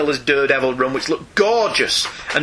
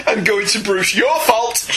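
real laughter breaking into higher-pitched speech; laughing through words; 3 semitones higher than usual. Real, not acted. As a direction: talk through helpless giggles at an absurd idea. Cracks up imagining the absurd scene.

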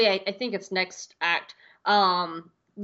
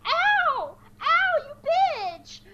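much higher yelp of pain, slowed; much slower than usual. Retold or imitated. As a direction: shriek ow at the scratching cat. Scratched by the cat, yelling.

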